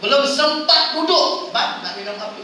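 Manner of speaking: loud, quick, high call of a coffee shop waiter; eager waiter. Retold or imitated. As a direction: greet the customer before he sits. Warung waiter greets customer eagerly. (pelayan warung yang bersemangat)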